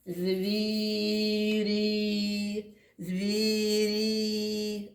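Звірі drawn out sound by sound; stretched sounding-out. Shown as a demonstration. Teacher sounding out a word for children. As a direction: lengthen Звірі to let the vowels be heard. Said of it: "останній звук який?".

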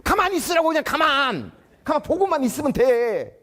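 high, loud angry snap at a junior; angry senior teacher. Retold or imitated. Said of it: "화를 내는 거예요".

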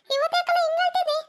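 high-pitched, worried questioning; concerned surprise. Acted. Adult asking crying Bharata what happened, concerned surprise.

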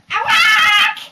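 loud high startled squawk. Acted. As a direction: squawk in shock. Jack grabbed by giant.

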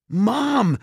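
high, loud cry for her mother; alarmed cry. A narrator's reading. Mallory sees the wrecked house and cries out for Mom, high and loud. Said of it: "Mallory gasped".

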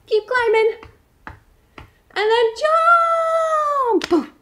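high, eager climbing voice; rising excitement up the tree; excited climbing. Acted.

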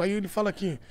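ordinary talk, nothing marked

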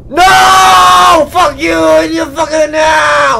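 high, loud outburst of rage; long howled NO, then cursing; furious shout. Real, not acted. Player raging at the one who got him, shouting.

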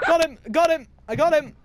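high, loud victory shout; triumphant yell. Real, not acted. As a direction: cheer the kill. Triumphant kill in game.

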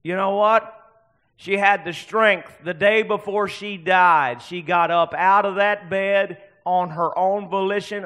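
loud, driving testimony; full-force preaching. Real, not acted. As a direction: hammer out each phrase for the congregation.

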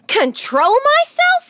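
high, loud, stretched-out indignant yell; child's incredulous high holler. A narrator's reading. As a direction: yell back in outraged disbelief. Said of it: "yelled Fern".

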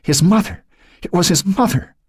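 high, halting whimper. A narrator's reading. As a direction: stammer it out in a small, high voice. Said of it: "she whimpered".

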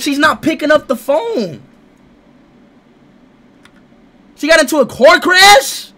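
loud, high, frantic shouting; panicked yelling. Real, not acted. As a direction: yell it out in a panic. Panicking as girlfriend won't answer.

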